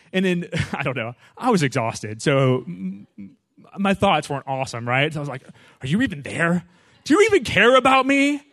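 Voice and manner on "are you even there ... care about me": rising high and loud in despair; anguished retold prayer; desperate cry. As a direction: cry out to God from pain. Painful spot in race.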